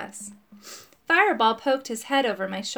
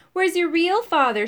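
bully shout; 7 semitones higher, 5 dB louder than usual. A narrator's reading. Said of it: "shouted Peter".

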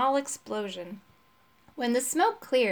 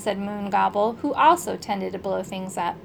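ordinary delivery, nothing marked

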